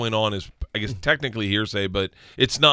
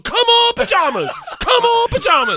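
very high, loud chanting yell; rooting cheer; 7 dB louder than usual. Retold or imitated. Family around her as she opens pair six, loud high cheering. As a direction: imitate a crowd willing it on, voice pushed way up.

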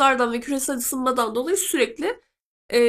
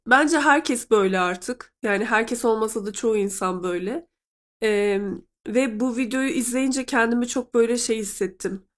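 ordinary delivery

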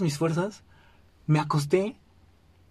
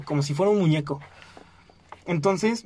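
ordinary talk

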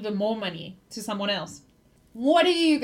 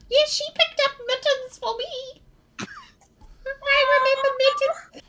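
much higher, slow quavery granny voice; old granny; slower than usual. Acted. Granny in the crowd remembering her cat, high and slow. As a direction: dote like a grateful old lady.

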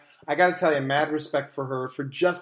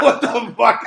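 laughing, louder mock curse; laughing mock outburst; 5 semitones higher, 7 dB louder, slower than usual. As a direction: blurt it out while laughing. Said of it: "exactly what Bobby did".

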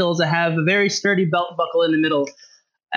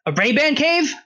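incredulous shopkeeper; 8 semitones higher than usual. Acted. Shopkeeper doubts story.

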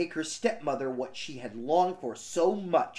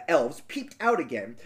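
normal talking voice

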